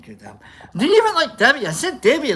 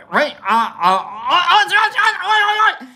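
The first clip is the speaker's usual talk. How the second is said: excited buildup; 10 semitones higher, 4 dB louder than usual. Pretend. Aussie chant wind-up.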